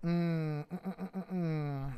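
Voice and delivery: string of short hummed mms; thinking hum. Real, not acted. Artist pondering the drawing, absent-minded humming. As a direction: hum it while working out the next step.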